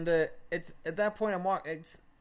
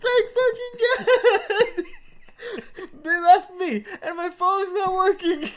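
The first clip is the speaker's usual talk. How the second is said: high, loud outpouring of relief; mock desperate yelling; 12 semitones higher, 8 dB louder, slower than usual. Storyteller imitates lost friend finally found, high desperate yelling. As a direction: wail it like a rescued drunk friend.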